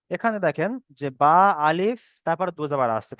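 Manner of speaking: normal talking voice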